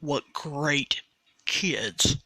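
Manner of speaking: normal talking voice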